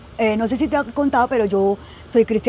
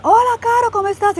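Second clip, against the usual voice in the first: imitating a friend's bright hello, pitched way up; high cheery greeting; 12 semitones higher, 3 dB louder than usual. Friend greets her at church.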